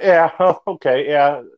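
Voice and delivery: laughing yeah, okay. Retold or imitated. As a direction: chuckle through it. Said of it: "And we had a laugh about it".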